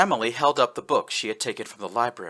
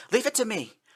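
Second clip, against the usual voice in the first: a bit too loud; 12 semitones higher than usual. Acted. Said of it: "a little louder than she meant to".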